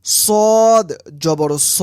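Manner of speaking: সদ জবর স said slowly with rounded lips; rounded sad. Shown as a demonstration. Teacher shows students সদ with a rounded mouth. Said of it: "মুখ গোল করে উচ্চারণ করতে হয়".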